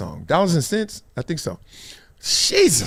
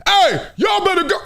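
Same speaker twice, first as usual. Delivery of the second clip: coach yelling. Retold or imitated. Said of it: "he was".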